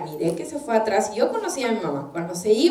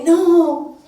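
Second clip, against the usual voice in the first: loud, high mock-defiant no; hammed-up teenage irritation; irritated refusal; 6 semitones higher, 9 dB louder than usual. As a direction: act out a youth refusing an order. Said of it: "El estado de irritación de sus corazones".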